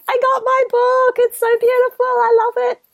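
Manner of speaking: very high, gushing squeal of delight; excited fans. Retold or imitated. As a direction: imitate thrilled fans in a tiny high voice. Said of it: "excited people popping up on Instagram and Facebook".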